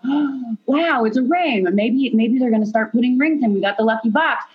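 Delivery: a bride-to-be relives finding the ring, surprised, high and wondering; amazed surprise. Retold or imitated. Remembering the moment she saw the ring, a high amazed gasp.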